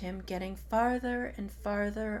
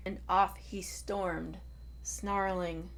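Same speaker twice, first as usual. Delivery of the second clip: usual speaking voice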